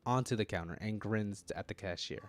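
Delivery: ordinary talk, nothing marked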